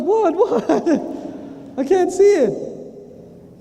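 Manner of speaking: high, stretched comic wail; hammed-up frantic cluelessness; panicked confusion. Man with book behind his back, comically panicked.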